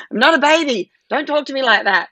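indignant on her daughter's behalf; mock exasperated child's objection; frustrated. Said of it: "she must just be so frustrated".

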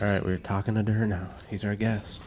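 ordinary talk, nothing marked

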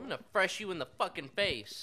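loud joking threat; mock threat. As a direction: bark it at the customer.

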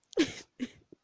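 high loud laugh bursting out; laughing at her own story; loud burst of laughter. Real, not acted. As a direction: crack up loudly. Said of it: "I'm laughing and crying".

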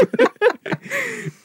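squealing laugh; breaking into a high squeal of laughter. Real, not acted. Laughing after her story.

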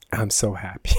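low, soft deadpan sarcasm; quiet ironic understatement; sarcastic. Retold or imitated. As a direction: dryly pretend to be delighted. Meeting the dreaded neighbour again.

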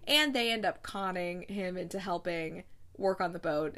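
ordinary delivery

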